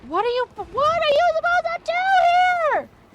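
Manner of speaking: very high, loud shrieking; frantic scream. Real, not acted. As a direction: scream in helpless panic.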